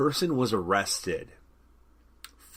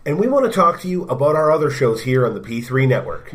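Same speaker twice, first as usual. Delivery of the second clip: bright promo host voice; promo announcer; 5 semitones higher, 10 dB louder, faster than usual. Acted. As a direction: hype up the network shows. Network promo read.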